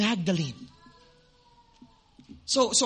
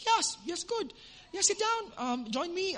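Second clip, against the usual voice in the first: woman's eager welcome; 12 semitones higher, 5 dB quieter than usual. Retold or imitated. Woman welcomes him warmly, retold.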